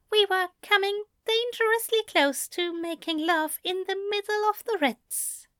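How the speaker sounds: high-pitched lover's voice; aroused lover's reply. A narrator's reading. Aziraphale answering Crowley at the Ritz, high lover's voice. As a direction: hold it high all through the line.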